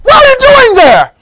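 high, full-force acted yell; shouted question. Driver yelling at a stranger in the woods, shouted. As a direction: holler it across the distance. Driver yells to lost man.

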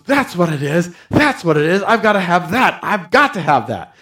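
eager, quickened rabbi voice; mildly excited retelling of a man who finally sees it; a touch of eager excitement. Retold or imitated. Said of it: "the guy got all excited".

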